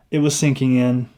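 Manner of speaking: ordinary delivery, nothing marked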